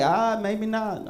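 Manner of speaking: slow, drawn-out mock doubt; doubtful shrug. Comic giving up on believing in aliens, slow and doubtful. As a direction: let the belief go with a sigh. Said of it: "so now I'm like".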